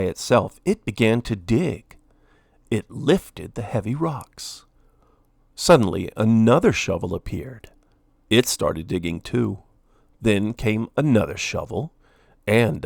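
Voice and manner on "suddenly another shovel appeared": ordinary delivery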